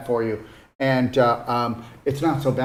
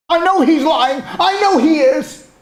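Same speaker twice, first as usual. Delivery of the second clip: high, loud mocking shout of an angry viewer; 9 dB louder, slower than usual. Mocking an angry TV viewer, loud high shout. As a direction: yell it like someone ranting at the TV.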